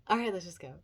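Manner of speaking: ordinary talk, nothing marked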